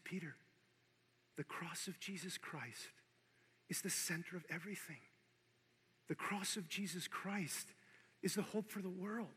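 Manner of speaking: hushed through tears; tearful. Retold or imitated. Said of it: "he's bawling his eyes out".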